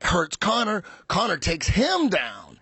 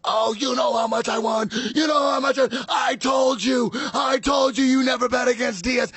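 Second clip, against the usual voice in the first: shouting friend gloating over a win; excited shouting; 7 semitones higher than usual. Retold or imitated. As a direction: yell it, giddy and smug. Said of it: "Joey's like".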